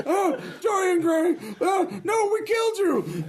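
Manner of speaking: high, laughing panicked cry; shocked shriek. Acted. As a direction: yelp it out in mock horror. GM voices startled kayaker.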